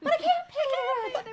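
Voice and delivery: very high, slow damsel wail; damsel in distress. Pretend. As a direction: plead like a fainting melodrama heroine. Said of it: "anytime I hear vaudeville".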